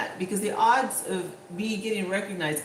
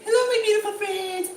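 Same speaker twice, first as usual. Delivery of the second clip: much higher, bright, sing-song host greeting; sweet vlog greeting; 13 semitones higher, 4 dB louder than usual. Retold or imitated. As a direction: gush over the viewers like a super-sweet vlogger. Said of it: "he's super sweet".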